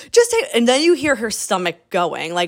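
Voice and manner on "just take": desperate plea. Retold or imitated. Bride desperate in the elevator.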